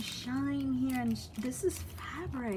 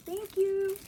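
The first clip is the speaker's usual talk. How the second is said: high squeaky thanks; 11 semitones higher, 5 dB louder than usual. Real, not acted. Thrilled thanks to gift sender.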